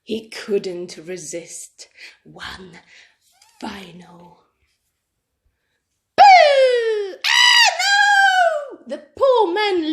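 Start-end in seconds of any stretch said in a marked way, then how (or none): 0.0-4.4 slow, whispered build-up; hushed suspense. A narrator's reading. Suspense before last boo. 6.2-7.2 loud high shouted boo; big scare shout. Acted. Cub scares the man. 7.2-8.8 very high shocked yell; startled cry. Acted. Man startled.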